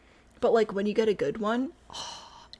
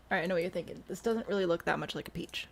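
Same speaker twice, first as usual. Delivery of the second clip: ordinary talk, nothing marked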